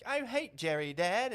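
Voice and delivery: put-on high, loud child voice; mock little kid. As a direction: play an indoctrinated kid telling Dad. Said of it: "there was little kids".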